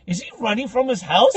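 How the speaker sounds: high, loud mock astonishment; incredulous lady. Lady marvels at the running brother, mock incredulous. Lady's amazed question, retold.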